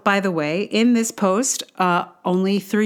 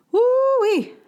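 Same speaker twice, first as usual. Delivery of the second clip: exclamation. Real, not acted. Exclaiming how hard it is.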